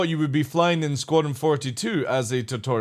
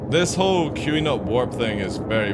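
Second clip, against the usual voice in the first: ordinary talk, nothing marked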